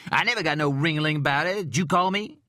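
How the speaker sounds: loud, high offended protest; indignant outrage. Acted. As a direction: act wronged and loud. Agent indignant at detective.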